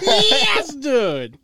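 very high, booming cheer; excited shout. Real, not acted. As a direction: holler it with total excitement.

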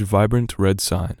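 plain reading voice

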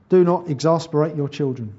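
ordinary talk, nothing marked

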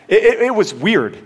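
giggling at the awkward memory; laughing. Real, not acted. Awkward foot washing memory.

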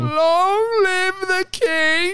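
high, drawn-out crier's call; royal proclamation. Pretend. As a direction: proclaim it like a herald. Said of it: "proclaiming himself king".

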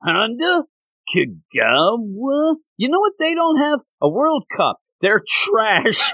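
mock the players' idols; imitating mocking coach. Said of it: "he's like".

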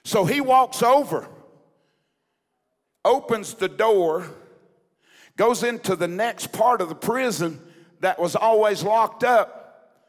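loud, emphatic revival storytelling; impassioned preaching. Acted. Preacher telling church of a pastor's prison escape, impassioned. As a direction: preach the suspense with punched key words.

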